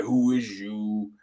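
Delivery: slow, drawn-out mock put-down; mock snobby dismissal. Streamer imitates snapping back at a chatter, slow mock put-down. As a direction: imitate a haughty brush-off, slowly. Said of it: "come back and be like".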